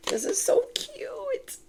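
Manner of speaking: hushed, delighted whisper. Real, not acted. Reader whispering to viewers about a cute card, hushed. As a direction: breathe it out in awe.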